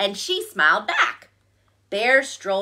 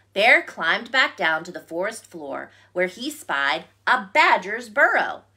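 usual speaking voice

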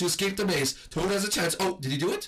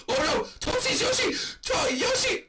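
high, loud excited yelling; excited shouting; 10 semitones higher, 3 dB louder, slower than usual. Real, not acted. Gamer cheering as Yoshi escapes the maze, shouting.

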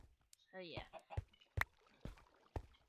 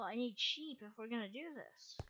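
usual speaking voice

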